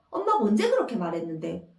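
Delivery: put-on a girl talking back to her mother; arguing back. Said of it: "이렇게 따지는 거예요".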